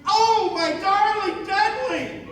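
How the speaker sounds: high, drawn-out melodramatic cry; narrated heroine's scream. A narrator's reading. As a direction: call out high and slow, hammed-up damsel. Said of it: "stands and screams".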